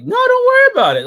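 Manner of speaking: very high, loud put-on dismissal; hammed-up high-pitched brush-off; mock Solon. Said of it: "Solon's like".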